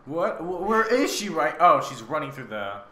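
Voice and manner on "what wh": ordinary talk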